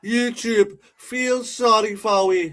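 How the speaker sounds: high, slow mock Jamaican accent; playful Jamaican accent impression; Jamaican accent imitation. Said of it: "Jamaican accent is absolutely classic".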